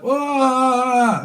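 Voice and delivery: high hammed-up wah; mock silly wail. Reader mocking a flailing partner with a silly wah. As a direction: flap like a headless chicken.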